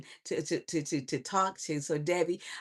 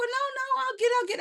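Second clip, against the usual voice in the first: very high, hurried insisting; mock panicky interruption, high-pitched; acted flustered protest. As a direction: squeak out a flustered refusal. Acting out a flustered exchange.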